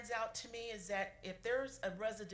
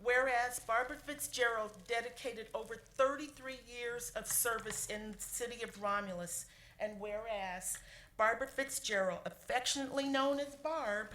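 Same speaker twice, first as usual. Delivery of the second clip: formal resolution reading; 3 semitones higher, 3 dB louder than usual. Acted. Clerk reading the retirement resolution aloud in a formal voice. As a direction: proclaim each whereas to the room.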